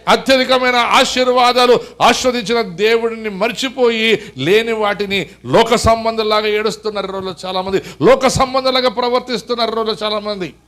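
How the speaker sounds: slow, loud exhortation; loud, slow fervent appeal; preaching. Acted. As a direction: press the brothers hard, word by word.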